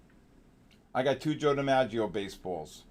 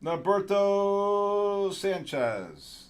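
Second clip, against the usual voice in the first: higher, very slow ballpark announcer call; stadium announcer; 6 semitones higher, much slower than usual. Pretend. Host hyping a trader's name, stadium announcer style. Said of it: "I got a good announcing voice".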